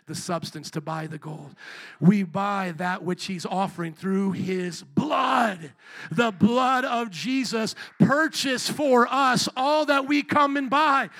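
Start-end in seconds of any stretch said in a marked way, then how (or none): 2.0-11.1 slow, crying preacher's shout; preaching at full force. Acted. Preacher crying out to the congregation about the blood. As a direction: cry it out slow and loud.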